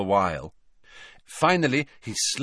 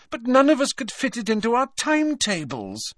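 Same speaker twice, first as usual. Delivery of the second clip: very high, pleading explanation; earnest protest. A narrator's reading. Hermione explaining the timetable to Hagrid, high pleading protest. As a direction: plead your excuse.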